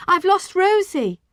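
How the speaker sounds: high, slow, loud wail; lost child's distressed cry; nearly crying. A narrator's reading. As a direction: blurt it close to tears. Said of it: "I said, nearly crying".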